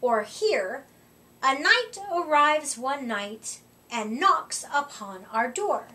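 ordinary delivery

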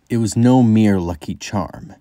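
usual speaking voice

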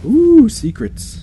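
high, stretched-out ooh; intrigued, drawn-out excitement; playful delight. Real, not acted. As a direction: coo it high and slowly. Delight at hidden area.

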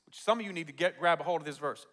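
usual speaking voice

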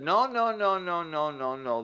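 mock an establishment protesting, tumbling down in pitch; emphatic objection. Psychology voiced rejecting a theory.

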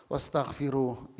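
ordinary talk, nothing marked

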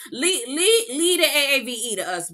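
laughing through the words. Real, not acted. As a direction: laugh while telling her off.

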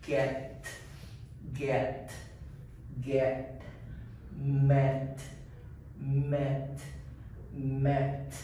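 "get", "met", "let" quiet and clipped; short E words. Shown as a demonstration. Teacher drilling short E words for repetition. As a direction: say each short and crisp. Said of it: "the short E sound".

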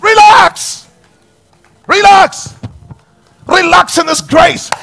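high, loud insistent call to relax; shouted relax. Real, not acted. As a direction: belt it out to the congregation. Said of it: "preacher urging the crowd".

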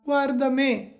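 the lame fox pointing at herself. A narrator's reading. Fox warns. (la volpe furba, voce molto più alta)

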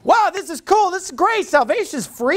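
high-pitched, thrilled wonder; imitated excited convert. Preacher imitates a sinner learning salvation is free, high excited amazement. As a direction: burst out like an amazed new believer. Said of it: "people will just be like".

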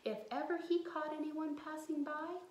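fast hushed storyteller whisper; storyteller whispering about the devil; spooky whisper. A narrator's reading. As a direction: whisper it like a scary secret.

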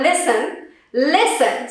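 listen with a silent T, like lissen; correct pronunciation. Shown as a demonstration. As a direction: say listen without any T. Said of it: "the T in listen is silent".

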